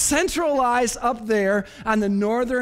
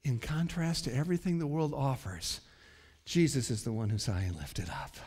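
very low, soft sermon voice; hushed solemnity; 8 semitones lower, 10 dB quieter than usual. Real, not acted. Preacher lifts up Jesus above the world, hushed and low. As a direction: bring it down to a quiet, weighty close.

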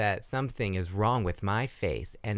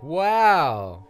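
mock surprise; 3 semitones higher, 7 dB louder than usual. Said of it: "he's, like, surprised".

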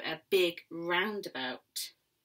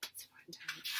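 hushed breathy aside; whisper; 3 semitones lower, 15 dB quieter than usual. Real, not acted. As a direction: lean in and whisper.

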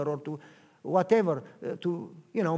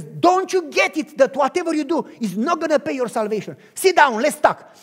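loud, firm, slowing to "sit down"; loud firm command; firm insistence; 7 semitones higher, 11 dB louder than usual. Acted. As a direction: insist they stop and listen. Boss insists firmly.

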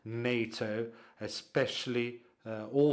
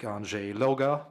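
ordinary delivery

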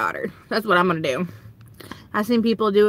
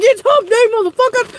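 camel voice; 11 semitones higher, 4 dB louder than usual. Retold or imitated. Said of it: "He's like".

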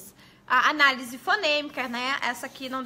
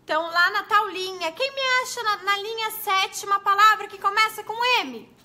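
teacher to the class; 7 semitones higher, 6 dB louder than usual. Shown as a demonstration. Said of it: "Pode numerar as linhas".

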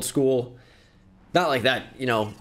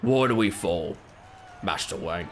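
low, soft, lightly British butler voice; a half-hearted go at the old butler; mock solemn butler line; Alfred from The Batman; 8 semitones lower, 4 dB quieter than usual. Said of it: "He goes".

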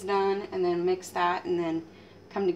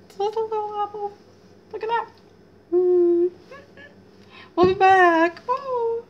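high, fast cooing then a loud call; cutesy excited; 13 semitones higher, 9 dB louder than usual. Real, not acted. Cook coos at a tiny apple to viewers, high and loud. Cute voice over little apple.

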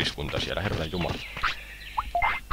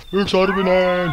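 slow, loud and much higher shout of its own name; proud unicorn cry; 12 semitones higher, 8 dB louder, much slower than usual. Acted. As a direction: announce yourself like a triumphant unicorn. Einhorn ruft seinen Namen.

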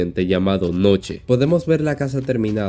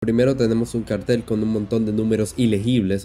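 ordinary talk, nothing marked

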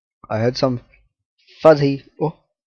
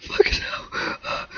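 wheezing, voiceless laugh through the words; slow, barely voiced through helpless laughter; laughing too hard to voice it; breathless laughing; 7 dB quieter, slower than usual. Real, not acted. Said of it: "I'm not crying. I'm fucking laughing here".

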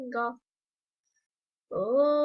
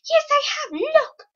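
squeaky bickering character; 13 semitones higher, 6 dB louder than usual. Acted. Quarrel continues, high voice.

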